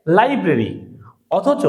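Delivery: ordinary delivery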